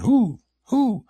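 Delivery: high, loud imitated owl call; owl hoots. Camper imitating owls coming in on them, high loud hooting. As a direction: hoot twice like an owl closing in. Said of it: "they were owls".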